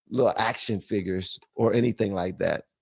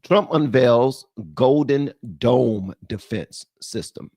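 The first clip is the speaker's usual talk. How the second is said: news headline read; 3 semitones higher, 3 dB louder, slower than usual. Shown as a demonstration. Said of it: "In other news".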